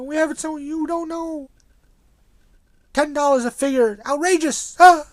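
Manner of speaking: put-on high, loud cranky old-timer; old man. Old-timer scolding young whippersnappers over figure prices, cranky old man voice. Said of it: "my old man voice".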